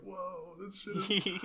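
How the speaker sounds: high, awed little voice; excited Roxy. Retold or imitated. Roxy tugging Rudeus's sleeve, gasping in high awe. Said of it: "she kept, like, pulling on Rudeus's sleeve".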